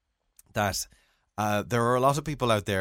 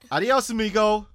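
higher, louder playful farewell; calling goodbye; 9 semitones higher, 5 dB louder than usual. Real, not acted. Calls goodbye to child.

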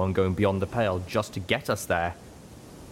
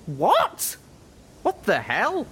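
much higher, stunned and indignant; sudden high disbelief; shocked outburst; 13 semitones higher, much slower than usual. Acted. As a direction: caught off guard, blurt it out. Littlepip shocked by accusation.

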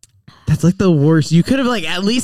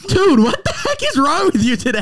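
laughing; 11 semitones higher, 3 dB louder than usual. Real, not acted. Partner bursts out laughing again.

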